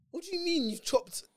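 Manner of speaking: high, slow disbelieving mum voice; shocked mum. Retold or imitated. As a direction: mum hearing something outrageous. Voicing mum's shocked reaction.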